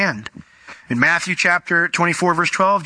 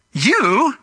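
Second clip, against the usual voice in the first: "You" hit much higher and punched; emphatic you; 9 semitones higher than usual. Acted. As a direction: throw the weight onto "You". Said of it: "the word ye is emphatic in the Greek".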